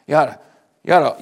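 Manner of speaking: normal talking voice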